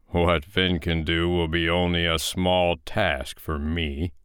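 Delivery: slow, heavy giant voice; lumbering storybook giant, sure of himself; boastful giant. Acted. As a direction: boast ponderously.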